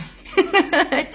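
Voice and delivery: a high, loud exasperated laugh; burst of laughter. Real, not acted. Doctor laughing at Facebook deleting her lives, loud high laugh. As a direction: laugh it off in frustration.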